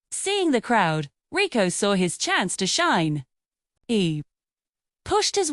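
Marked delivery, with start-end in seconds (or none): none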